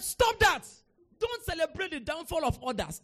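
loud, high scolding shout; acted-out preacher's telling-off; shouted rebuke. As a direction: cut him off with a forceful rebuke. Retells his rebuke of young man.

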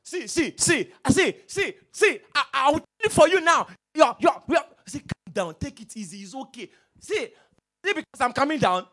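a hammed-up angry church member yelling; mock quarrel. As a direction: shout like a person losing their temper. Acting out a heated quarrel.

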